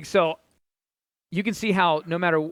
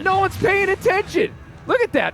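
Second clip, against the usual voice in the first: high, loud, incredulous call; excited commentary; 14 semitones higher, 5 dB louder than usual. Real, not acted. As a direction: shout in disbelief at the play. Caster reacts to the run.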